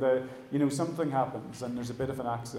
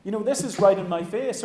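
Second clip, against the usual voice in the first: high, louder exasperated plea, mildly hammed; a touch of mock frustration aimed heavenward; annoyed complaint to God; 8 semitones higher, 4 dB louder than usual. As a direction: throw up your hands at God, half-mock annoyance. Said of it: "That gets us really annoyed".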